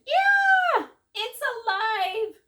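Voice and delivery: shrieking very high and loud; terrified scream. A narrator's reading. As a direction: scream in horror, slow on alive. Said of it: "The lady screamed".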